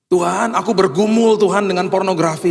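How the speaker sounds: high, imploring cry to God; pleading prayer. Acted. Believer confessing a struggle to God, pleading prayer.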